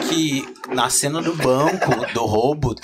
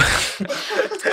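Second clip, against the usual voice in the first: high breathy laugh. Real, not acted. Bursts out laughing mid-apology.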